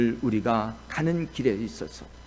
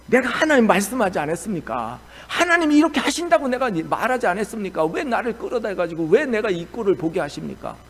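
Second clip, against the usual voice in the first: Jonah's indignant complaint; 6 semitones higher, 5 dB louder than usual. Retold or imitated. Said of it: "괜히 속이 상하는 거예요".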